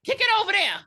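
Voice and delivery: high loud mock order; mock command. Imitating the armed character ordering Amy, high mock yell. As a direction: yell it at gunpoint.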